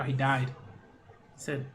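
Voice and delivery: ordinary talk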